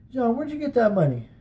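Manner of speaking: high, sharp accusing aunt voice; retold scolding aunt. Aunt confronting nephew over stolen money, imitating her sharp demand. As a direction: call him out like a stern aunt. Aunt catches boy at arcade.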